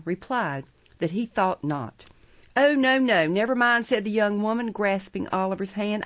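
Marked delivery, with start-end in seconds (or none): 2.6-3.9 higher, louder waving-off; hurried reassurance. A narrator's reading. Young woman declining a doctor, emphatic reassurance. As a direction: wave the helpers away emphatically. Hysterical woman waves off help.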